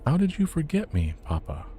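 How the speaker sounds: put-on high, wounded child's question; hurt little boy. A narrator's reading. Boy asks why his father forgot him, hurt child voice. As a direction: ask why he forgot you.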